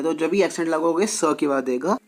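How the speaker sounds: usual speaking voice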